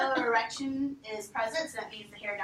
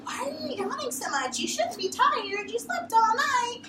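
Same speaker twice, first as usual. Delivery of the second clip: high, scolding owner voice, louder at the end; annoyed owner; 13 semitones higher, 6 dB louder than usual. Retold or imitated. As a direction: play the puzzled owner scolding the dog. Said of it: "And the owner's like".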